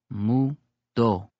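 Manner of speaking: Mu, a pause, then do, each syllable spoken carefully; spoken slowly. A narrator's reading. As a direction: say Mu do slowly to get it right. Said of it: "I speak it slow so as to get it right".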